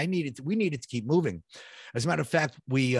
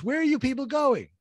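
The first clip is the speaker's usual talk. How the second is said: a high, mildly raised call from a stranger; retold yell; 10 semitones higher than usual. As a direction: call down the stairwell, pitched up high. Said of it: "yells down to us".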